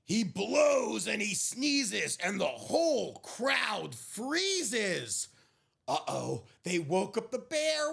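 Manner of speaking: slow, hushed storybook reading; gentle picture-book narrator; story narration. A narrator's reading. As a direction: read it like a bedtime story. Picture book read aloud.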